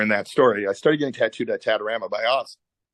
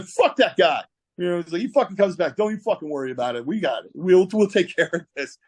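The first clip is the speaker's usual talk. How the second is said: higher-pitched brash reassurance; retold defiance; 7 semitones higher than usual. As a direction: act out a cocky protective friend. Oz's defiant backing retold.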